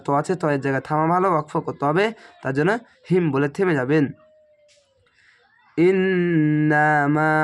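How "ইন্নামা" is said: ইন্নামা very slow, নন held long through the nose; slow recitation with nasal hold on ইন্নামা; nasal ghunnah. Shown as a demonstration. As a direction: chant ইন্নামা holding the ghunnah. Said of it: "এখানে ওয়াজিব গুণনা".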